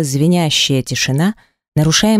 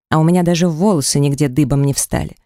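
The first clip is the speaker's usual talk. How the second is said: usual speaking voice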